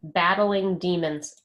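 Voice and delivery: Battling Demons said slowly, falling tune, for writing down; slow dictation. Shown as a demonstration. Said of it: "Riley's writing it down".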